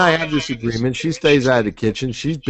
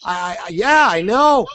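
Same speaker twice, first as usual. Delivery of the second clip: eager agreeing prospect; 6 semitones higher than usual. Retold or imitated. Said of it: "getting John to just be saying".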